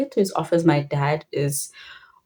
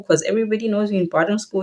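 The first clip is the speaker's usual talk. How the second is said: ordinary talk, nothing marked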